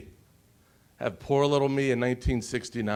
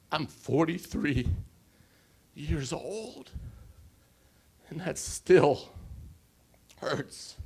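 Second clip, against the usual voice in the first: slow, voice breaking, last word barely voiced; holding back tears as it still hurts; choked up; 4 semitones higher, slower than usual. Real, not acted. Speaker telling the audience the old wound, choked up. Speaker moved recalling grandfather's slur.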